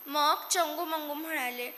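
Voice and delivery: usual speaking voice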